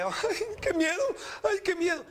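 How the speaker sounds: high, whimpering mock fright; acted fear. Preacher acts out a person struck by calamity, mock fear. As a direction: wail as if terrified, hammed up. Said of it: "cuando le llega algo que usted le".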